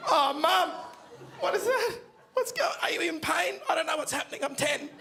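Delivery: high, wailing mock sob; ten-year-old's hammed-up teary whimper, pitched high; mock crying. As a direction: whimper like a scared kid. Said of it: "I started to cry".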